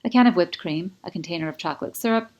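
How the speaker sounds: plain reading voice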